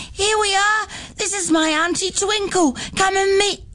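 bursting in to show off her aunt; excited child calling. Acted. Nettie calling to her classmates, high and eager. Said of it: "ran into the classroom shouting".

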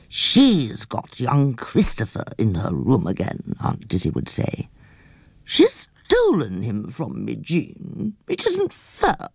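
put-on slow, aggrieved old aunt; Aunt Dizzy's voice. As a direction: sulk like a jealous aunt.

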